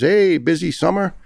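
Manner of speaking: high, sneering mock question; mocking jab. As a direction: tease him like a smug doctor. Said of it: "He says".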